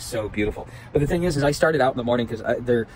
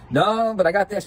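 imitating the hiker; 8 semitones higher than usual. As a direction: quote the guy offering soda in a higher voice. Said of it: "he's like".

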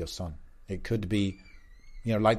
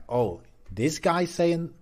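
higher-pitched, drawn-out mock intrigue; intrigued reaction; 7 semitones higher, much slower than usual. Voicing people first hearing of the Prophet's message, intrigued. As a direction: imitate someone taken by what they hear.

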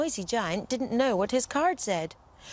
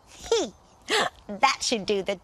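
naughty and pleased with herself; sly fairy giggle. Acted. Fairy gloats over her naughty spell, slyly. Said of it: "smiled the naughty fairy".

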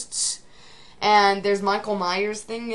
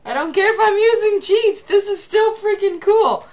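excited cheer; 11 semitones higher, 5 dB louder than usual. Real, not acted. Cheering a win in the game.

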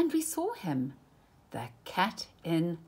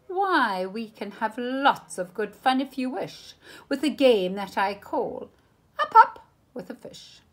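cat voice rising higher to a grand finish; showman cat; 5 semitones higher, 5 dB louder than usual. Acted. Cat presents his game to the children, grand.

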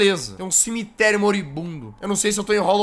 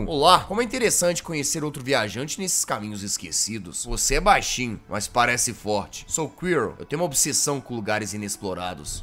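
Quirrell voice; 8 semitones lower, 5 dB quieter than usual. Acted. Quirrell meeting the knight, low soft mysterious voice. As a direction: murmur like a polite curious explorer.

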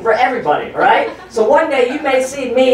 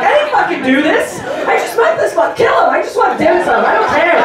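panicked denial; 7 semitones higher than usual. Retold or imitated. Comedian retelling his panic on a crowded train. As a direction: plead your innocence in a rising panic. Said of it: "I'm like".

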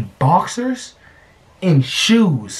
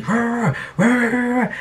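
high yappy growl; imitated dog growl; 8 semitones higher, much slower than usual. Imitating the neighbor's chihuahua growling, high and yappy.

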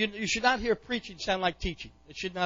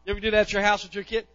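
ordinary delivery

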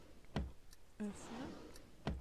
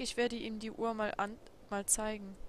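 normal talking voice

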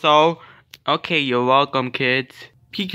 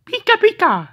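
high squeaky Pikachu cry; 4 dB louder than usual. Pretend. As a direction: squeal it like the little yellow creature. Playing Pikachu toy voice.

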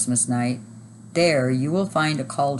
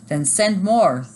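loud, raised command; forceful narrated boom, higher and louder; thundering; 5 semitones higher, 5 dB louder, slower than usual. A narrator's reading. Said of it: "thundered the Christmas knight".

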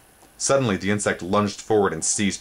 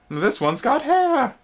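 very high, slow, louder insect voice; surprised insect; 4 dB louder, slower than usual. A narrator's reading. Spindlebug examining Twig, high slow surprised voice. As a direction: marvel at the hair.

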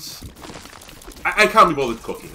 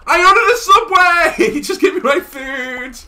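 high, loud and slow indignant yell; much higher, louder, stretched rant; 8 dB louder, slower than usual. Real, not acted. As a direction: drag it out in comic outrage. Ranting about the order.

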